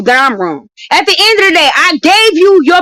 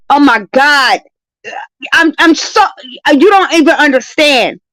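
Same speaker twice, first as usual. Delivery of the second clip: ordinary delivery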